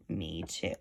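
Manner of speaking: small mocking joey voice; little joey. A narrator's reading. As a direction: tag along with mother's scorn. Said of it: "the young kangaroo in her pouch said".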